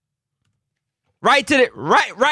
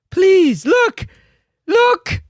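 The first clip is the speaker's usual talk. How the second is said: higher pleading cry of look; desperate plea; 7 semitones higher, much slower than usual. Real, not acted. Fan begging the player on screen, high desperate plea. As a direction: beg him to look up.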